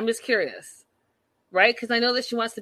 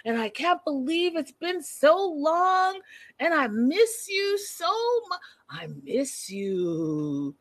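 mock lovesick; 6 semitones higher, slower than usual. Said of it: "saying, you know".

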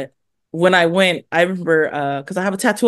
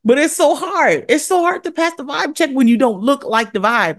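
exasperated; 7 semitones higher than usual. Real, not acted. Exasperated about vibe check.

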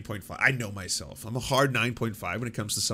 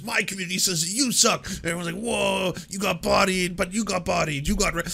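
higher-pitched mock jeering; mock fan taunt; 6 semitones higher than usual. Rival fans crowing over a debate, mock taunting. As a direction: hype it up like a rowdy onlooker. Other side and onlookers jeering.

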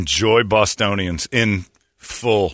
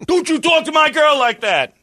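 much higher put-on voice, protective snarl; mock jealous boyfriend; 3 dB louder than usual. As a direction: play a hammed-up jealous Boston boyfriend. Mock jealous boyfriend defending girl.

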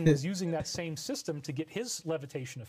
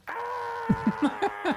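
high shrieking demon scream. Retold or imitated. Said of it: "screaming in my face like a demon".

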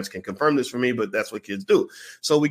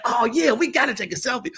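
eager fan; 13 semitones higher, 3 dB louder than usual. Retold or imitated. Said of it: "She's like".

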